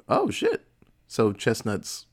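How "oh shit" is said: shocked. Retold or imitated. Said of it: "And they're like".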